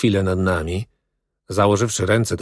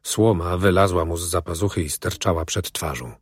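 usual speaking voice

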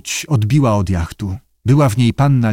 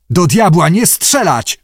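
high, forceful angry command; hissed order; 6 semitones higher, 4 dB louder than usual. A narrator's reading. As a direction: snap out an urgent order not to fire. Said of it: "syknął kapitan". (gniewny, syknięty rozkaz)

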